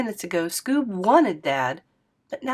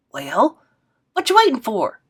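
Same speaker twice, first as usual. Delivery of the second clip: much higher, louder playful granny; squealy hurry-up; 3 semitones higher, 6 dB louder than usual. Acted. Grandma hurrying Scoob to the bathroom, high playful. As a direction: shoo him off teasingly. G-Maw urging.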